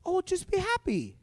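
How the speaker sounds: high, soft, airy mimicry of a consoler; mock cheery friend. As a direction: imitate a friend breezily cheering someone up.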